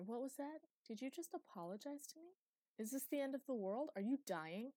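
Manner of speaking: high, teasing astonishment; mock disbelief. Eli teasing Luke about his first apology, in high mock disbelief. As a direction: act floored by an apology.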